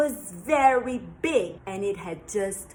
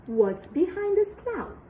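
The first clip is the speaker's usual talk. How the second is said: startled gasp; 9 semitones higher, 4 dB louder than usual. Acted. Startled by noise.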